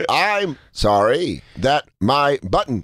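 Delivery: one word at a time, mechanically; mock talking-machine delivery; Speak and Spell. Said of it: "Speak and Spell over here".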